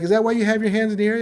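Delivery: fast, high, loud quizzing; mock quizzical coworker; quick curious question. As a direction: fire it off curious.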